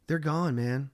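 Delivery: soft, low reassurance; calm him down gently; soothing. Retold or imitated. Reassuring a paranoid tripping friend, soft and low. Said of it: "You did say it like that".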